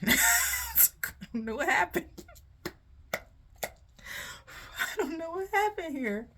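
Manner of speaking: high, squealing words through real laughter; helpless laughing. Real, not acted. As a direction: laugh so hard the words climb and stretch. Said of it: "I'm laughing".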